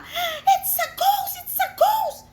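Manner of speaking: high, loud terrified cry of ghost; panicked shriek of fear; frightened holler. Acted. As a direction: scream it like they've seen a ghost. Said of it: "They holler".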